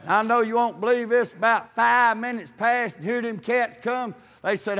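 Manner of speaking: ordinary talk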